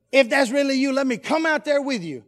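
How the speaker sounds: loud, high call across water. Acted. Peter asking Jesus to let him join him, calling out. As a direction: call out eagerly over the storm.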